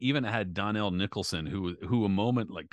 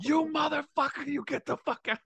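much higher, strained angry yell; imitating furious trainer; 3 dB louder than usual. Furious trainer cursing his fighter out. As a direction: curse him out in a rage. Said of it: "he was pissed".

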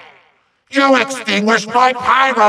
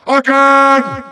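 high, sustained order; commanding cry; 6 semitones higher, 4 dB louder than usual. Acted. Villain orders another attack on the intruder, high commanding cry. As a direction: call it out like an evil master.

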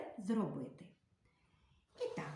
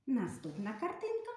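ordinary talk

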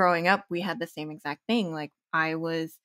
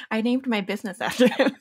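says it through a laugh, louder; laughing while telling it; 7 semitones higher, 8 dB louder than usual. Real, not acted. Laughing as she tells the host about her father.

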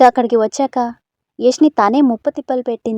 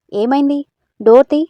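calling out; 4 semitones higher, 7 dB louder than usual. Acted. Calling through locked door.

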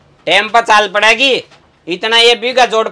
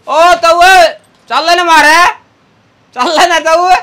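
pleading call; 6 semitones higher than usual. Acted. Begging the old man.

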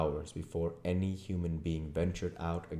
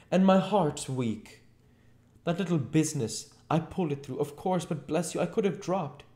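high-pitched, whining excuses; high, plaintive complaint; miserable Mr Marvel; 12 semitones higher, 5 dB louder than usual. A narrator's reading. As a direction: plead weakness to your bullying master.

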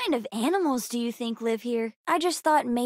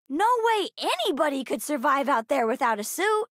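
normal talking voice

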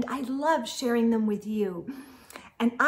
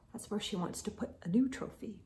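quick breathy whisper, like sharing a secret; whispered aside; 4 semitones lower, 13 dB quieter, much faster than usual. A narrator's reading. Narrator whispering a secret aside to the listeners. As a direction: hurry the words out in a whisper.

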